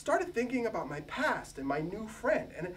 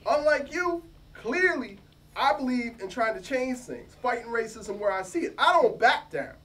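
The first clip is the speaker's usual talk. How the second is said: louder, punchy pushback; defiant retort; 3 semitones higher, 6 dB louder than usual. Acted. New hire pushing back at his rival, loud and defiant. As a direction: stand your ground and hit back hard. Mosaic defends himself, defiant.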